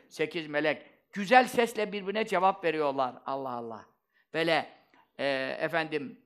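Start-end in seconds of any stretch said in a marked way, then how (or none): none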